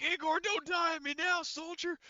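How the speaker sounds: very high-pitched plea to a downed soldier; Horgus urging on the wounded. Acted. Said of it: "he's like".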